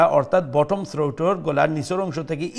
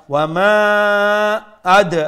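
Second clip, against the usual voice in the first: tajweed chant with a bouncing final d; loud, held recitation with qalqala on the last letter; recited demo; 5 semitones higher, 8 dB louder than usual. Shown as a demonstration. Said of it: "দাল কালকালা করবো".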